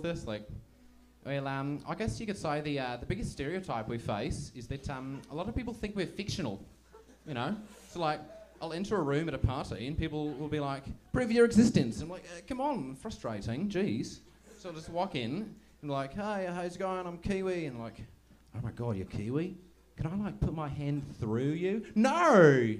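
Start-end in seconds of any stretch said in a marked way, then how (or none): 1.2-21.8 put-on New Zealand accent, often higher; Kiwi accent. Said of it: "trying to do a Kiwi accent". 21.9-22.7 high, very loud outraged no; exasperated yell. Acted. Acted outraged reply to silly question.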